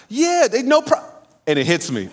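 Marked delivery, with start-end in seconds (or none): 0.1-1.0 high-pitched easy yes, cut short; breezy agreement. Retold or imitated. Driver agreeing to give the guard a ride, breezy agreement. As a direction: agree readily, voice up high, then break off mid-word. Agreeing readily before remembering the wobbly car.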